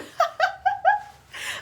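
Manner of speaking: a real high burst of laughter. Real, not acted. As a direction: break into a loud laugh. Laughs after a joke.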